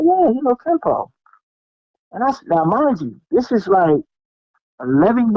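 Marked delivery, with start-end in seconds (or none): 0.0-1.1 higher, offhand yeah; casual confirm. Retold or imitated. The cousin confirming, lightly voiced. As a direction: shrug it off like it's nothing. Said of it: "He said".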